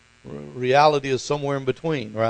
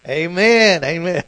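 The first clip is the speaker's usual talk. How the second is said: put-on louder, higher Georgia-accented amens; Pastor Mayo, Georgia accent; 5 semitones higher, 8 dB louder than usual. Pastor trying to get his father down, imitated Georgia accent. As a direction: mimic a Southern pastor's insistent amen in a Georgia accent. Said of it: "with that Georgia accent".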